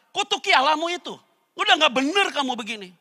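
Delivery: high, loud scolding; furious wife demanding he curse God; angry wife. Acted. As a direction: scold your husband in anger.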